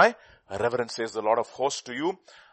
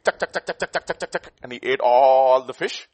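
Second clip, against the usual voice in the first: beak pecking sound; 7 dB louder than usual. Retold or imitated. Imitating beak eating.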